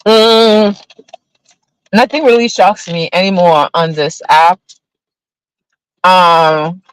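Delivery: talking around chewed ice; chewing ice. Real, not acted. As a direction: mouth full of ice. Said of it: "sorry, I'm chewing ice".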